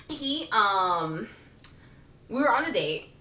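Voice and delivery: ordinary talk, nothing marked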